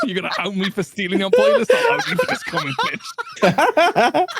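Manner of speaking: loud, high, breaking up with laughter; real laughter bursting through the words; laughing threat. Real, not acted. Laughing over an embarrassing chat comment.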